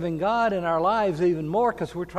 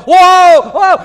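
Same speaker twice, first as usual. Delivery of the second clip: a high, much louder Whoa shouted twice; mock yell; 17 dB louder than usual. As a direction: yell it like someone startled in the din. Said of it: "someone else yelled".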